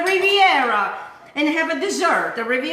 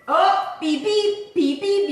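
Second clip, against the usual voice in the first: oven beep imitation; 5 semitones higher, much slower than usual. Imitating the oven timer beeping.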